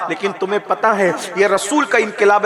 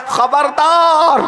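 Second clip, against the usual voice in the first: stern proclamation; 7 semitones higher, 3 dB louder, much slower than usual. Acted.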